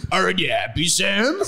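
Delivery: put-on thick accented character voice, lifting on the name; reply earnestly in character; Hagrid, solemn. Hagrid replies to confused Sam, solemnly, in character. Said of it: "Hagrid replied solemnly".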